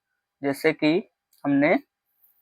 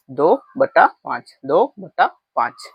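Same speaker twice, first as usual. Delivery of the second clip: slow model reading; slower than usual. Shown as a demonstration. Teacher showing the class how to read the fraction, slowly. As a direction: read दो बटा पाँच slowly, word by word, like a teacher. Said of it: "ऐसे पढ़ा जाता है".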